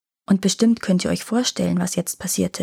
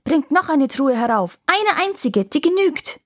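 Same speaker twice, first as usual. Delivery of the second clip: shouting down from above; 7 semitones higher than usual. Acted. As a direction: yell down from the top of the tower. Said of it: "Da schrie er nach unten".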